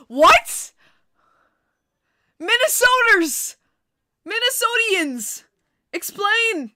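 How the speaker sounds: loud, high, drawn-out disbelief; shocked shouting. Real, not acted. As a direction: yell it like the fact is outrageous. Shocked by the Snapple fact.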